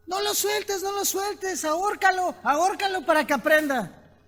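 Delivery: very high, shouted cheering; shouting ants. Acted. Ants below yell at the last ant holding on. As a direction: yell it up from below, egging her on. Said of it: "le empiezan a gritar".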